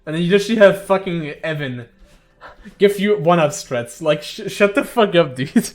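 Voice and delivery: loud, laughing playful rant at a friend; hammed-up outrage, half laughing; mock anger. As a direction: pretend to be pissed at Bubs. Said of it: "now I'm kinda mad".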